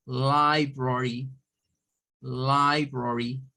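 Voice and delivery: LIBRARY said "lai-bro-ri", drawn out; English pronunciation demo. Shown as a demonstration. Teacher introducing word for biblioteca, slow careful demo. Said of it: "la pronunciación de library".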